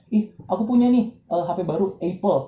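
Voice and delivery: high bragging voice saying "Apple" as eipel; show-off wrongly says "Apple"; mock show-off. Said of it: "terus bilangnya Apple gitu".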